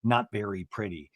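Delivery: low, soft, slow man's voice; Eddie. Retold or imitated. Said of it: "And Eddie goes".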